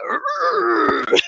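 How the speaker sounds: high exasperated groan; frustrated groan. Retold or imitated. Answering the coach in her head, a frustrated groan. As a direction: groan it out in defeat. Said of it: "And I'm like".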